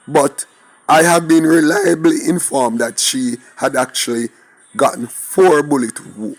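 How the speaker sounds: ordinary talk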